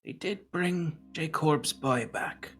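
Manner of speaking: high, slow, far-off seer voice; high floating seer, slow and solemn; Ada the Seer. Acted. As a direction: speak like an ancient oracle, drawn out and lofty. Ada addresses the council.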